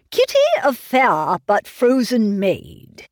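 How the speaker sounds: slow, musing recital of the line; reciting verse. Acted. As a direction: linger over the remembered verse. Repeats the riddle's first line.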